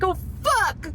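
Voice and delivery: high, tearful curse; crying out. Real, not acted. Wife overwhelmed by husband's blame, crying out. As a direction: swear as the sobs break. Breaks down after husband's retold taunt.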